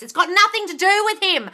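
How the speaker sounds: high, heated interrogation; exasperated scolding. Real, not acted. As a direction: demand answers, fed up and high-pitched. Coach scolds exasperated client.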